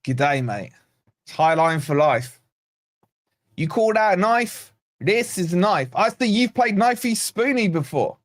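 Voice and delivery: amateur put-on Aussie accent; bad Aussie accent. Streamer obliging chat's request, bad Aussie accent. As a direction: have a go at a hammy Australian accent. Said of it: "Worst Aussie accent ever".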